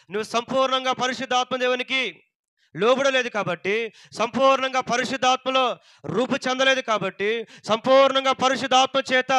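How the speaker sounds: loud, high, driving sermon delivery; impassioned pulpit proclamation; full-force preaching. Real, not acted.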